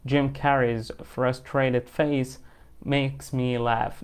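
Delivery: very slow, word by word, each word spaced out; slow dictation. Shown as a demonstration. Teacher reads example sentence slowly for students to repeat. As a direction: dictate it slowly so learners can follow.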